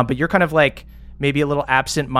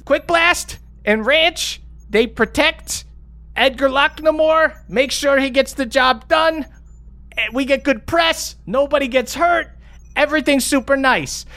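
much higher, tumbling defensive explanation; flustered Stella; 11 semitones higher than usual. Acted. Stella justifying the crew deal to accusing players, flustered and high. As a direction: squeak out the excuses.

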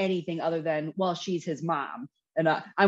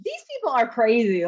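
incredulous; 6 semitones higher, 4 dB louder than usual. Retold or imitated. Retold reaction to a survey.

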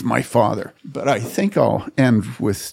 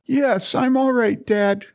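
slow, high, drawn-out reassurance; high emphatic acted reply; son reassuring dad; 13 semitones higher, slower than usual. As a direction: stretch it out to convince an anxious father. Retold son insists to father.

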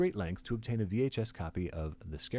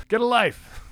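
much higher, louder shouted "Get a life!"; hammed-up Shatner yell; William Shatner; 12 dB louder than usual. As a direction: bellow it like a TV star losing patience. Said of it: "As, uh, William Shatner said".

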